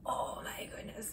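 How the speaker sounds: whispered, slow, soft exclamation. Real, not acted. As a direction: breathe it out in awe. Mock surprise at child.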